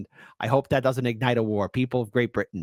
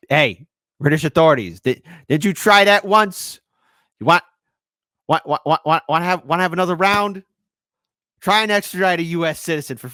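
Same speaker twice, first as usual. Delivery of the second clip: loud, raised-pitch taunt to the authorities; mock defiant challenge; 5 semitones higher, 6 dB louder than usual. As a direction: call them out loudly, daring them.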